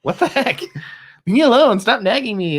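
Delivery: high, mock snapping of annoyance; hammed-up grump pushing him away; mock irritated. Husband imitating his own grumpy reply, high mock irritation. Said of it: "And I'm like".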